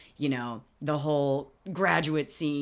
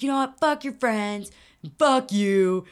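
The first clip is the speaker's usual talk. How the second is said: raised, indignant mock rant; louder, higher retold fury; yelling; 5 semitones higher, 6 dB louder, slower than usual. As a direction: tell them off in an acted outburst. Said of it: "him, like, yelling at her immediately".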